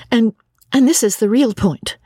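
a hint of an embarrassed young woman; ashamed Lucy. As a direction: stammer out the shame of it. Lucy confiding about gossip.

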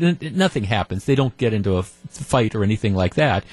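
usual speaking voice

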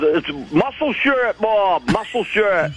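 lightly voiced slow, dim cousin; slow dopey cousin. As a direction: drag it out like a dim lug. Voicing cousin Wayne retold.

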